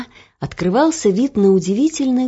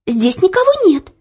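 put-on high, loud scared girl; frightened; 12 semitones higher, 4 dB louder than usual. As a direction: insist nobody's there, scared. Frightened girl in empty hall.

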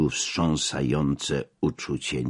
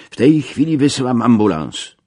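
louder, higher, decisive announcement; urgent promise; 6 semitones higher, 6 dB louder than usual. A narrator's reading. Ambassador responding to the major's report, urgent and decisive. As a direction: promise help at once, emphatically.

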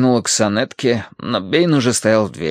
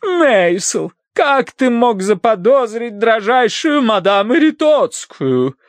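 high, slow theatrical indignation; reproachful wife; 12 semitones higher, 4 dB louder, slower than usual. A narrator's reading. Wife rebukes husband for suspecting madame, indignant. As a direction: scold him for daring to doubt.

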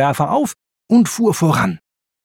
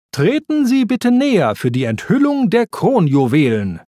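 higher-pitched, lightly voiced ceremonial announcer; royal guard announcing; 7 semitones higher than usual. A narrator's reading. Royal guard to guests at the unveiling, announcing. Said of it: "kündigte eine königliche Wache an".

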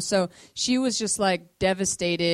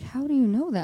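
higher-pitched, baffled question; mock puzzled questioning; puzzled pastor; 6 semitones higher than usual. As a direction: imitate someone asking in disbelief. Pastor questioning her, acted.

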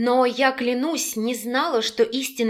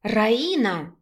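plain reading voice

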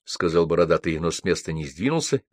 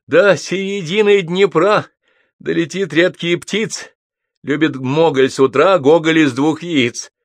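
high, loud verse recitation; blurted epigram; 10 semitones higher, 6 dB louder, slower than usual. A narrator's reading. Drunk Гнедич recites his epigram on Гоголь, blurted out. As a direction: declaim the silly rhyme, high-pitched. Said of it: "выпалил".

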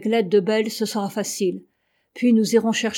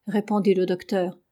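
usual speaking voice

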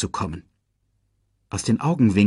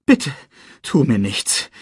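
begging; 4 semitones higher than usual. A narrator's reading. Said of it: "bettelte Scar".

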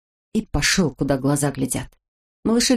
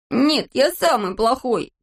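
higher, slow wailing insistence; sulky self-blame; 6 semitones higher, slower than usual. A narrator's reading. Boy insists to girl he is worst, sulky self-blame. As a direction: whine it stubbornly, drawn out. Crying boy rejects comfort.

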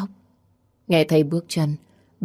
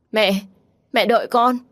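calling out; 6 semitones higher than usual. Acted. Daughter runs after mother.

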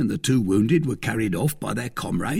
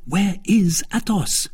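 higher, slow, insistent demand; impatient cry; 8 semitones higher, slower than usual. A narrator's reading. D'Artagnan pressing the innkeeper for Athos, a higher slow cry. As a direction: cry it out, stretching each word. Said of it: "cried D'Artagnan".

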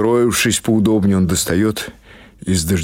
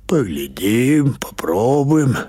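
put-on slow and much higher, sly old granny; old woman's voice; 6 semitones higher, slower than usual. Baba Pasha teasing Gavrila about tasting, slow and high. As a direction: draw it out like a crafty old woman.

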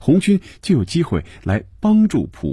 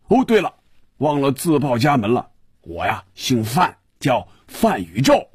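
muffled, booming-nose character voice, pitched high; effusive phone greeting in a thick, stuffed-up voice with a chuckle; 范宇宙; 7 semitones higher than usual. A narrator's reading. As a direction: play a gushing, muffle-voiced caller. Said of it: "有些瓮声瓮气的声音说".

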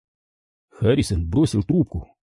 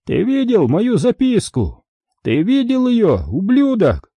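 high, slow menacing taunt; gloating high-voiced anger; angry, triumphant; 11 semitones higher, slower than usual. A narrator's reading. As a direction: draw out the threat in a high voice. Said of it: "В голосе звучала злость".

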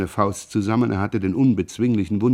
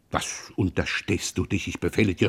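suppressed rage; 3 semitones lower, 7 dB quieter than usual. Acted. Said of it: "Pierre war wütend geworden".

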